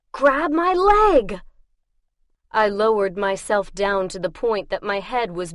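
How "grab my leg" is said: higher, louder, slow shout; slow desperate shouted command. Acted. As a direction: yell it so he can't ignore it. Desperate command under attack.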